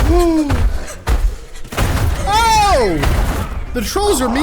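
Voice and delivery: high, loud indignant cry; outraged. Real, not acted. Gamer crying out high and loud at mean trolls. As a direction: protest the trolls' cruelty.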